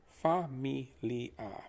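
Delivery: familia said very slowly, each syllable clear: fa-mi-li-a; slow model pronunciation. Shown as a demonstration. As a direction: spell out familia slowly for the student. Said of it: "Please try pronouncing that after me".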